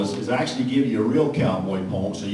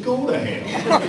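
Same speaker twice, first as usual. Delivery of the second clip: laughing; slower than usual. Real, not acted. Punchline, laughing through it.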